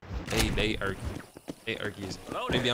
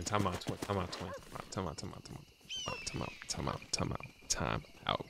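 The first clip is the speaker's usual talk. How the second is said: repeats dropping softer and softer into a whisper; fading to a whisper; 2 semitones lower, 11 dB quieter than usual. Real, not acted. Streamer to his chat, repeating time out as it fades to a whisper. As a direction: let each time out fade until only breath is left.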